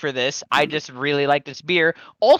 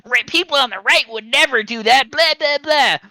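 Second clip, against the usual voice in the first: mocking right-wing voice; 7 semitones higher, 4 dB louder than usual. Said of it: "going like".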